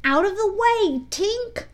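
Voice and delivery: high-pitched warning call; shout. A narrator's reading. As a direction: shout the fairy out of the way. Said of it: "he shouted".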